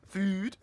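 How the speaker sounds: "Food" in an odd accent; accidental food accent. Real, not acted. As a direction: say "Food" questioningly, with an odd vowel. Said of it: "What is this food accent".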